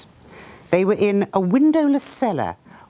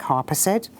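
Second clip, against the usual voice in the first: ordinary delivery, nothing marked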